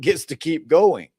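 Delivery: usual speaking voice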